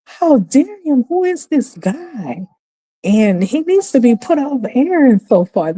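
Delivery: replaying her own indignation; outraged disbelief. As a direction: voice climbing in offended shock. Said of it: "And I was like".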